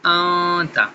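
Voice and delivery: آن تا with the نون hidden in a long nasal آن; ikhfa recitation. Shown as a demonstration. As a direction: hold the nasal sound of آن before تا. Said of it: "صحیح طریقہ جو ہے کہ وہ اخفا کے ساتھ ہے". (آن تا میں نون کا اخفا، ناک سے لمبی آواز)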